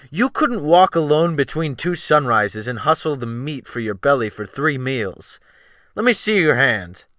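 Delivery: loud, heavy scorn from a hard captain; scornful sea captain. A narrator's reading. Wolf Larsen mocking the gentleman's inherited money, loud scorn.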